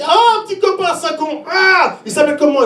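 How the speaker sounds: high-pitched mock astonishment; acted squeal of fatherly disbelief; mock shock. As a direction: play the scandalised dad, voice shooting up. Dad reacts to daughter's boyfriend news.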